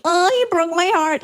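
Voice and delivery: high mocking baby whine. Therapist mocks her heartbreak in a high whine. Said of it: "She's like".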